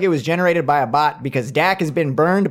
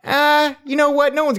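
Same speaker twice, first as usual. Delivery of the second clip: sudden high indignant outburst; 14 semitones higher, 4 dB louder than usual. Real, not acted. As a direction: rise up in defiance. Defiant outburst.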